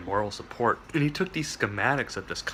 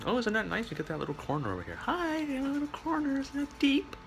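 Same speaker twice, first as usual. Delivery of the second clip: cooing high voice; 4 dB quieter than usual. Real, not acted. Talking to a hidden corner.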